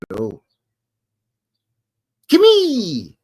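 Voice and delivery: ordinary delivery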